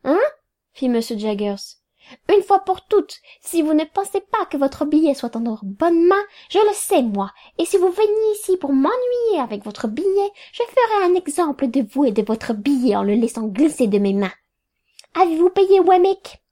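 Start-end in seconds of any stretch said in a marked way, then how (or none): 2.3-10.3 high-pitched, irritated telling-off; exasperated scolding. A narrator's reading. Lawyer warning the women not to bother him, exasperated. 15.1-16.4 high, loud, demanding; peremptory question. A narrator's reading. Lawyer checking the women have paid, peremptory.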